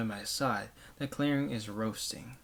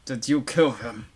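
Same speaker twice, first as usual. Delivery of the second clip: louder accusing demand; accusing yell; 7 dB louder than usual. Acted. Adults yelling at the child, an accusing yell. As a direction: hurl the accusation.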